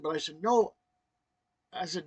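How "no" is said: sharp, loud, raised no; firmly forbid, acting it out; abrupt high-pitched refusal; retold rough refusal. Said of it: "I hate to be rough with it".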